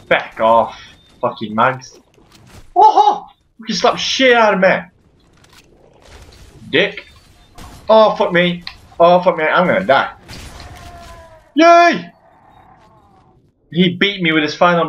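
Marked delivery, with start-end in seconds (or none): 2.7-3.3 short high "oh"; startled yelp. Real, not acted. Gamer getting slapped about, high yelp. Hit in game. 11.5-12.1 loud high-pitched yay; high cheer. Real, not acted. Gamer reacting to the fight, loud high cheer. Cheers amid dying in game.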